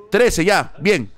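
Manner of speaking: normal talking voice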